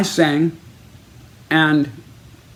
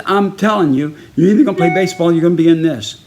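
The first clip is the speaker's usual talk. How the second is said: louder, rapid-fire warning; coach's ultimatum; 3 semitones higher, 4 dB louder, faster than usual. Retold or imitated. Baseball coach making player choose, forceful ultimatum. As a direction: lay down the law in a raised, hurried voice.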